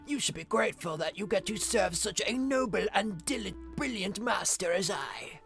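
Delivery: put-on gloating old dragon, pompous; grumpy old man. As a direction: lord it over them like an old tyrant. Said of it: "The old man voice of Ragnar".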